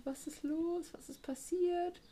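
confused residents. Retold or imitated. Bewohner fragen verwirrt nach.

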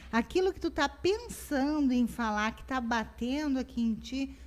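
ordinary talk, nothing marked

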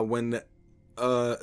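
ordinary talk

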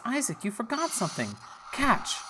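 tossing something, playful and high; mother voice. Acted. Mother tossing Isaac his tunic, high voice. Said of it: "And she says".

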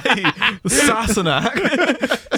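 put-on Scottish accent. Player ribbing English friend, exaggerated Scottish accent. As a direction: tease in a slow, hammy Scottish accent. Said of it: "didn't do a Scottish accent".

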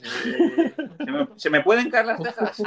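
high, hammed-up gullible surprise with a laugh; mock alarm. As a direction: play the naive friend who swallows the rumour. Acting a gullible listener.

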